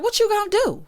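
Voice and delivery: high-pitched challenge, acted out; mock confrontation. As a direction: throw it down like a retold dare. Acted quote from the show.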